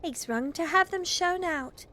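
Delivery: put-on slow, high, loud child's announcement; excited little girl. Phyllis tells the family the visitors are going, excited. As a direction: call it out as a relieved child.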